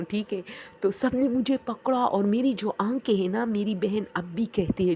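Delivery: ordinary talk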